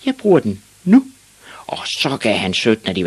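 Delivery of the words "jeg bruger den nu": put-on high, loud boy voice; shrill-pitched small hero declaring; little boy Ivan. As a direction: announce it forcefully in a kid's high pitch. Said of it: "sagde Ivan Olsen".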